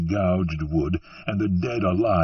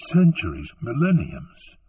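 plain reading voice

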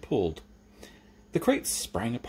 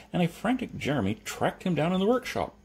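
usual speaking voice

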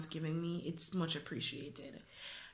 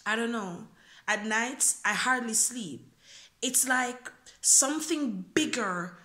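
spoken word poem; 4 semitones higher, 4 dB louder than usual. Acted. Poet reciting a freestyle poem to viewers, earnest spoken word. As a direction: perform the freestyle poem to your viewers, rising in feeling.

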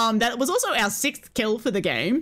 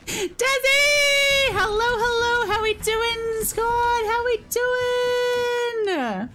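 high, sing-song hellos; cheery high greeting; 10 semitones higher, much slower than usual. Real, not acted.

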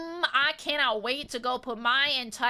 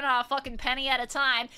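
normal talking voice

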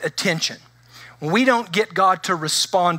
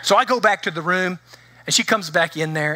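ordinary talk

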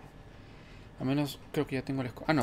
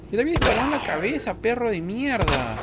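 high loud angry yelling; furious yelling; 9 semitones higher, 7 dB louder than usual. Real, not acted. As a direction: scream at the dog in fury. Shouting at attacking dog.

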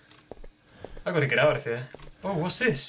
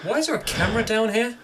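surprised; 8 semitones higher, faster than usual. Real, not acted. Finds camera in well.

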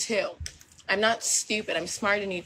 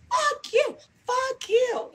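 much higher, squealed mock insult; aggressive comeback; much slower than usual. Acting out her aggressive side, a shrill mock outburst. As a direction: shriek it out in a put-on voice. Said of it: "aggressive side where I'm like".

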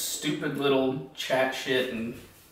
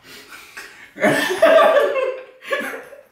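loud laugh; 9 dB louder than usual. Real, not acted.

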